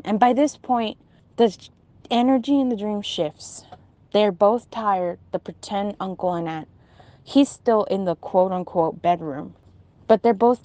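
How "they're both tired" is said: ordinary delivery, nothing marked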